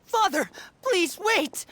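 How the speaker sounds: slow, urgent plea, drawn out; pleading call. Acted. Lyney begging Father to spare his siblings, slow pleading call. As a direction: beg her to stop, stretching each word.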